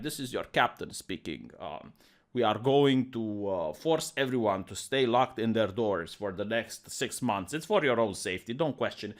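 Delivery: soothing authority hiding a scary order; calm captain announcement. Retold or imitated. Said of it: "they talk very calmly".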